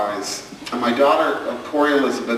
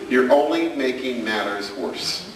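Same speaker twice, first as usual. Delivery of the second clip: normal talking voice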